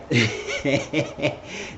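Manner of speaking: real chuckle, trailing along; amused laugh. Real, not acted. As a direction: laugh to oneself at the story. Own chuckle after the story.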